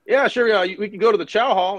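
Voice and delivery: lightly mocking a staff officer; dismissive officer. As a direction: brush it off like a bored staff officer. Logistics officer brushing it off.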